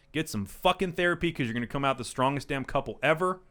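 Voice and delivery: usual speaking voice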